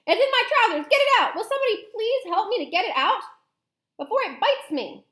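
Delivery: very high, loud pleading; frantic high yell, easing slightly at the end; panicked shouting. Acted. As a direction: shout for help in comic panic. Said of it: "the stupid cook still shouting".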